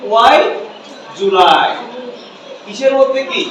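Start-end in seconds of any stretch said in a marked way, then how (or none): 0.1-1.7 "Why", pause, then "do you lie" as julai; native linking, broken down. Shown as a demonstration. Teacher breaks it down for students, "Why" then julai for "do you lie". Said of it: "কি বলে?".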